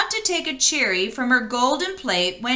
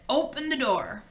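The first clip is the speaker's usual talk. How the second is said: a hint of a creaky, croaky frog; croaking voice; 5 dB quieter than usual. Frog at the door asks the princess, lightly croaking. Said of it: "in a creaking, croaking voice".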